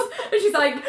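ordinary delivery

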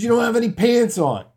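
high, loud accusation; arguing shout. Retold or imitated. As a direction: shout it back at him. Residents argue with Alan.

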